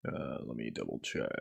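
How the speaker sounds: low, soft mutter to self. Real, not acted. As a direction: mumble it while checking. Muttering while checking.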